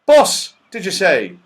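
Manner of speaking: loud, high, puzzled question; Saucepan Man shouting back. A narrator's reading. Saucepan Man mishears the call, shouting back puzzled. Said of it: "shouted back the saucepan man".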